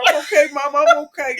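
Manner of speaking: high, laughing fake reassurance; forced cheerful. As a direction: act fine, laughing through it. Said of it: "come back like".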